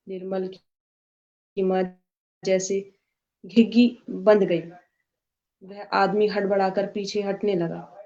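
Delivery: plain reading voice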